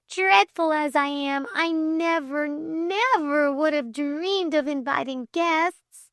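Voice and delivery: put-on high, earnest girl's protest; cried out. A narrator's reading. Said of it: "cried Flame".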